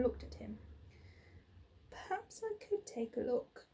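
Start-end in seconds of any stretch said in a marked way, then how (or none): 1.9-3.7 higher, softer timid offer; shy boy offering help; sheepishly. A narrator's reading. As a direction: offer it shyly. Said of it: "he said sheepishly".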